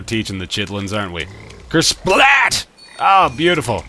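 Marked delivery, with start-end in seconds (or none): 1.7-2.7 high, loud comic splat; spoken sound effects. Pretend. Frog splats on wall.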